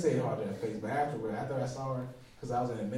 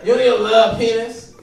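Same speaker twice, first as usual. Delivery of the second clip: loud comic telling-off; mock scolding; 7 semitones higher, 18 dB louder than usual. Man arguing with his own body, loud mock scolding. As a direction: scold it loudly, exasperated in jest.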